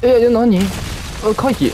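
sudden high, loud startle; startled. Real, not acted. Gamer ambushed by an enemy, startled out loud. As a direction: jump at a surprise attack.

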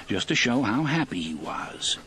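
usual speaking voice

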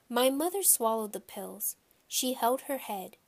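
usual speaking voice

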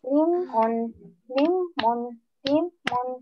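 lemon as lem, short gap, mon, three times; lemon split in syllables. Shown as a demonstration. Teacher hinting where to divide, lemon in two separate syllables. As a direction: break lemon cleanly after the m each time. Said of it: "how can we divide it?".

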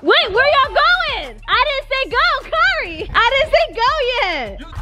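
exasperated false-start call; calling back racers. Real, not acted. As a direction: call it out high, stretching the words. Racers start before the signal.

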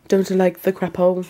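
normal talking voice

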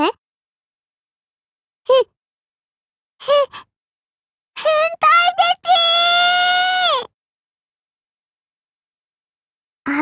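stammer, then a high, long-drawn shriek; appalled outcry. Acted. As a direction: stutter into a shrill drawn-out yell. (どもってから甲高く伸ばす絶叫)

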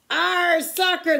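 high, loud, slow crew chant; crew yell. A narrator's reading. Crew echoes the captain about soccer: loud slow chant. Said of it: "the crew yelled".

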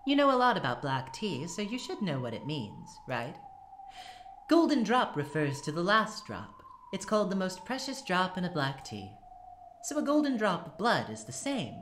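witch voice. Acted. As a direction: tease her like a gloating witch. Witch explains golden drop.

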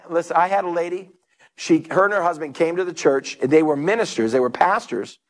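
normal talking voice

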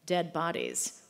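ordinary delivery

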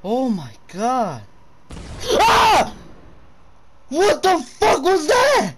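high, loud, slow shrieks of shock; real horror, drawn out yelling; shrieking in fright. Real, not acted. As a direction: scream at each sudden scare. Repeated jump scares.

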